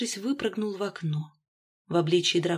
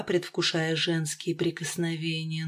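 usual speaking voice

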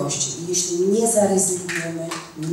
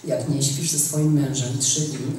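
a lightly lowered, softer preacher voice, quoting; soft, low mock sermon to a sister; mock pastor; 6 semitones lower, 4 dB quieter, slower than usual. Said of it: "taki pastor powiedział tak".

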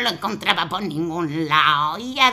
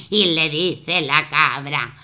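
plain reading voice

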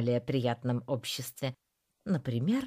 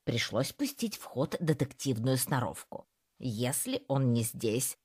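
ordinary delivery

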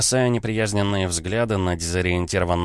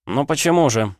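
raised-pitch mocking retort; spiteful sneer; 7 semitones higher, 4 dB louder than usual. A narrator's reading. Officer answers the outraged minister, spitefully amused. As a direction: toss it back with a mean smirk. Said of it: "Я зло усмехнулся".

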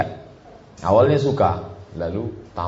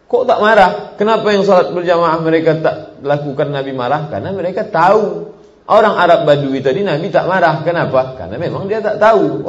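higher and louder, driving the point home; fiery preaching; 8 semitones higher, 7 dB louder than usual. Acted. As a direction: preach it at full force. Preacher rising to full force.

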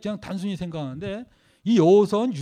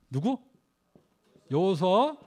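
ordinary talk

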